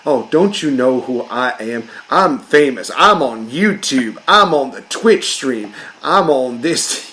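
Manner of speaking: slow, drawling southern show-off; southern accent. Acted. Mocking pricks who think they're famous, southern accent. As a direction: brag slowly in a put-on southern accent. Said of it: "I'm going into a southern accent".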